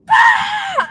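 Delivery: sudden high, loud mock yell; imitated yell. Wife imitating her husband yelling at his game, sudden high shout. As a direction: blurt a loud wordless shout. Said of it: "So you do yell sometimes. You're like".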